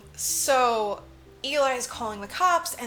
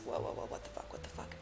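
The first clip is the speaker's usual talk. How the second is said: hushed, voiceless mock disbelief; imitated shock, barely audible; acted stunned whisper; 14 dB quieter, faster than usual. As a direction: whisper it rapid-fire as if reeling. Retelling robot's shocked reaction.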